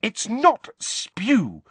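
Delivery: heated, drawn-out correction, pitched high; Hermione, heated. A narrator's reading. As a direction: object hotly, slow and high. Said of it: "said Hermione heatedly".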